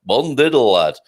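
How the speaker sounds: "Bon diddle, lad" in broad Yorkshire; Yorkshire accent. Shown as a demonstration. Host showing off Portuguese with a Yorkshire accent. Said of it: "My first Portuguese with a Yorkshire accent".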